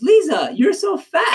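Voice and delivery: high, loud mock exclaim; family greeting. Quoting Filipino relatives greeting Lisa, loud high exclaim. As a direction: blurt it out like relatives at the airport. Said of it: "the first thing her family said was".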